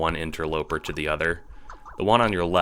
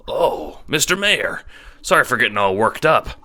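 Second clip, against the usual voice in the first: high, loud, deferential apology; hasty apology; 7 semitones higher, 6 dB louder than usual. A narrator's reading. Renegade apologizing to the mayor, hasty. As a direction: jump in with an eager, high-pitched sorry. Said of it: "you say hastily".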